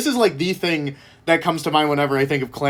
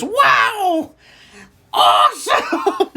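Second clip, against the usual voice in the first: high, slow, wide-eyed shout of delight; gee-whiz shout; much slower than usual. Retold or imitated. Jimmy cheering after Clam Man wins, high gee-whiz shout. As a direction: pitch way up and stretch out the amazement. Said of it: "and then Jimmy goes".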